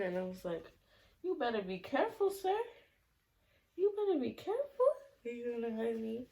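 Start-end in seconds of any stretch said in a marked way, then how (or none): 1.2-6.3 high-pitched parent cautioning a little one. Real, not acted. Breaks off to talk to toddler.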